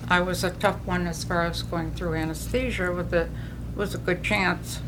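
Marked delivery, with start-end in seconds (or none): none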